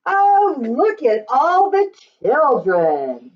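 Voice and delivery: ordinary delivery, nothing marked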